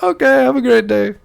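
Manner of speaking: high, loud sign-off that comes out Irish; a friendly goodbye in a Jamaican accent gone Irish; failed Jamaican accent. Retold or imitated. Delivery guy leaving, in a failed Jamaican accent. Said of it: "It is Irish".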